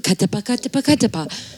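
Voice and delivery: rapid-fire syllable drill, evenly articulated; diction warm-up. Shown as a demonstration. Actor demonstrating theater warm-ups, crisp syllable drill. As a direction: rattle it off as a rhythmic tongue exercise. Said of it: "these warm-ups".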